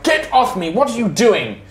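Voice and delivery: high, alarmed cry; shrieking to be let go; panicked woman. Acted. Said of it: "Velik's just like".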